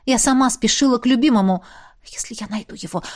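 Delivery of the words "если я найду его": soft, hushed thought; quiet aside. A narrator's reading. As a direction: drop to a near murmur. Inner hope, lowered voice.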